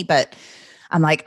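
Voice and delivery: ordinary delivery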